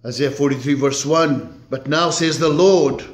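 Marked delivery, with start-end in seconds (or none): 1.7-3.0 raised, louder scripture reading; preaching scripture. Acted. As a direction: proclaim the verses to the congregation. Reads scripture at full force.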